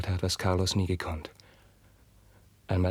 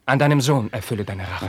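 louder defiant offer; louder, daring the king to punish; loud plea; 2 semitones higher, 7 dB louder than usual. Acted. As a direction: throw the words at the king. Carlos quotes his plea to the king.

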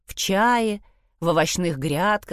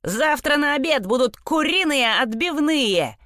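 barked; 8 semitones higher than usual. A narrator's reading. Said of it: "рявкнул Вилли".

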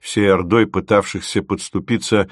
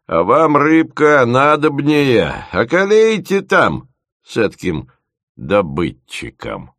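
насмешливо; 6 semitones higher, 3 dB louder, slower than usual. A narrator's reading. Дед насмешливо напутствует.

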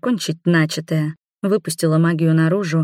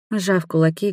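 usual speaking voice

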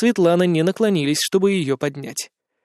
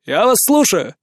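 calling out; 4 semitones higher, 3 dB louder than usual. Acted. Vendor calls to customer.

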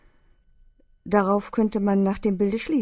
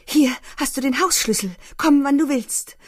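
higher, eager invitation; Leni voice; 8 semitones higher, 5 dB louder than usual. A narrator's reading. Leni inviting K. to come any time, eager and high. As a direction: press the key on him, eager and louder. Leni gives K. her key.

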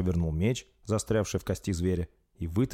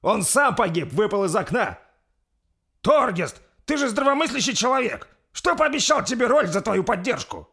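loud, high exasperated appeal; heated argument; 7 dB louder than usual. Acted. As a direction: argue back at full voice. Перепалка в тронном зале. (громко, в пылу спора)

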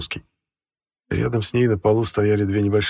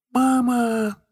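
put-on toddler calling. A narrator's reading.

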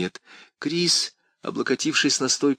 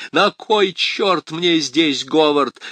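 громко, выше и медленно; выкрикни зло и медленно; angry shout; 7 semitones higher, 6 dB louder, slower than usual. A narrator's reading. Крис в истерике кричит. (злой крик)

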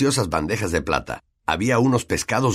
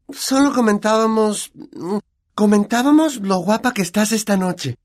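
put-on high girl voice, caught out; flustered girl; 13 semitones higher, 4 dB louder than usual. Hermione caught gossiping, flustered and high. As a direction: backpedal nervously, pitched up.

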